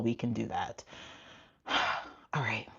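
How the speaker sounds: talking while winded, sighing; catching breath between words. Real, not acted. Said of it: "let me catch my breath".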